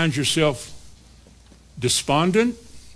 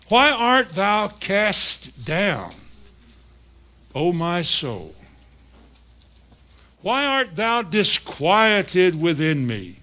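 slow, solemn psalm reading; preacher reads the psalm slowly to the congregation; scripture reading; much slower than usual. Acted. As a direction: read the King James words slowly, with weight.

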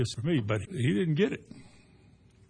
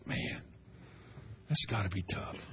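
much softer, lower, almost breathed; hushed sympathy; 7 semitones lower, 14 dB quieter than usual. Retold or imitated. Believer feeling God's burden for others, hushed and low. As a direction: barely voice it, heavy with pity. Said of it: "made you feel that".

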